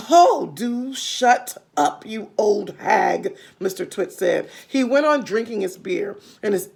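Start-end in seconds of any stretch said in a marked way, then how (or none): 0.1-3.4 put-on slow, loud, scornful old man; dismissive husband. Mr Twit telling his wife to shut up, slow and scornful. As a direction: brush her off with contempt.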